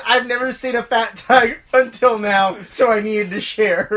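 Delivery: quoted caption. Retold or imitated. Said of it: "And it said".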